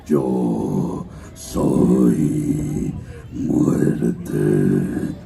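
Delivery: very low, very slow dragon rumble; deep slow dragon threat; Smaug dragon voice. Shown as a demonstration. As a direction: draw it out like a huge menacing dragon. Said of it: "El dragón Smaug diciendo".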